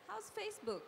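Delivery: lifted, high polite question; small-talk question. Acted. Bride-to-be asking her match about work, high light small talk. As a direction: pitch it up for light conversation.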